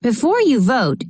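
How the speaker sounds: plain reading voice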